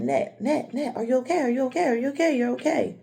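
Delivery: mother checking on her screaming daughter; mom waking her. Retold or imitated. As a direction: gently shake her awake with concern. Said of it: "she said".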